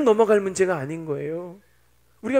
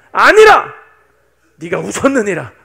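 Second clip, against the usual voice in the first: booming accusation; God's fearsome rebuke; 12 dB louder than usual. Acted. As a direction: thunder the contradiction, then press it home. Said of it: "무섭게 따지시는 거예요".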